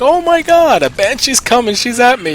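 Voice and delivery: high, loud mock panic about the Banshee; panicked yelling. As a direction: yell it like a teammate getting overrun. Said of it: "screaming and yelling".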